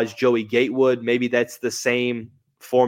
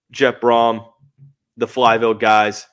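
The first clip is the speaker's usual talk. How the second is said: ordinary talk, nothing marked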